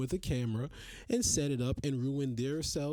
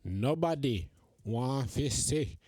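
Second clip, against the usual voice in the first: lightly put-on Jamaican patois, a bit lower and slower; mock Jamaican patois; 5 semitones lower, slower than usual. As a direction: tease him in a hammed-up Jamaican accent. Mocking gym filmers in put-on patois.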